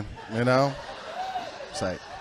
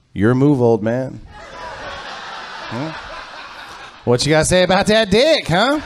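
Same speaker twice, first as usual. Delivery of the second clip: cocky taunting; 9 semitones higher than usual. Retold or imitated. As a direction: goad him, laughing and swaggering. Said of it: "I was like".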